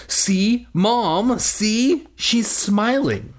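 high, indignant mock tattling; furious cry. A narrator's reading. Willa telling on her sister to mom, furious cry. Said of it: "Willa let out a furious cry".

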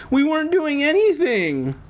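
very high character voice, weakly protesting; feeble, put-on. A narrator's reading. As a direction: play a feeble excuse way up high. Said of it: "Alex said weakly".

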